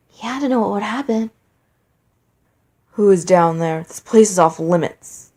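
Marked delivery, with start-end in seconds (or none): 2.9-5.3 deep, loud booming call; booming king. A narrator's reading. Asgore calls down into the crypt, booming. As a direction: boom out a warning into the dark. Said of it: "Asgore's booming voice".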